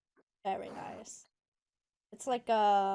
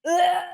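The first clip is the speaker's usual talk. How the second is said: disgusted gag; 6 semitones higher, 10 dB louder than usual. Real, not acted. Gross bean gagging.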